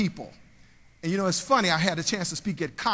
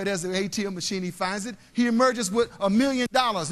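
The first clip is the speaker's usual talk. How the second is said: usual speaking voice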